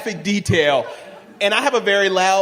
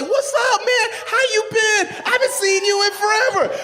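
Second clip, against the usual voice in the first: much higher, shouted hey-buddy hello; mock excited greeting. Two drunk old schoolmates greeting each other, hammed-up excited. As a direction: ham up a drunk overjoyed reunion.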